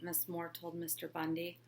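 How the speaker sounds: ordinary delivery, nothing marked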